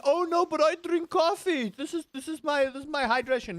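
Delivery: high mocking voice, well above his own; mocking coffee drinker. A high mock coffee drinker, dismissed by Uncle Rusty. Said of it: "A lot of people think".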